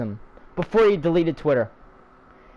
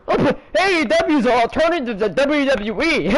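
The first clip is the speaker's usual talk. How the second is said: sarcastic mock; 5 semitones higher than usual. Mocking company line.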